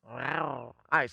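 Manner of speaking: ordinary talk, nothing marked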